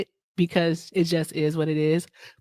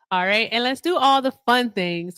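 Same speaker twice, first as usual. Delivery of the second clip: usual speaking voice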